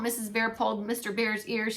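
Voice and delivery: plain reading voice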